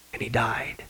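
solemn whispered ending; hushed whisper. Acted. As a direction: whisper it, almost no voice. Solemn close of each deathbed story.

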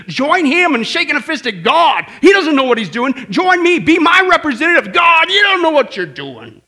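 loud, high-pitched defiance of God; Satan's accusing rant. Acted. Satan recruiting us against God, loud accusing rant. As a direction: rail at heaven as the accuser. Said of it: "Satan is riddling us with these accusations".

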